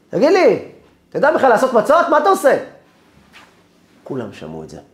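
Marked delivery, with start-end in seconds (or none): 0.1-2.6 high, full-volume scolding; angry shouting. Retold or imitated. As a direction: yell it so the next room hears. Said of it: "הוא צועק בקול". (צעקה כועסת בקול רם וגבוה)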